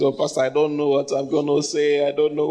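a hint of a nervous whiny son dodging the pulpit; mock timid son. Pastor mocking a church son asked to preach, mildly whiny. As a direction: lightly act out the reluctant son. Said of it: "you do this".

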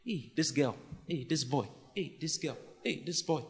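a lightly voiced distracted thinker; mock inner voice. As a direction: muse softly like someone daydreaming. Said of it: "You're always thinking".

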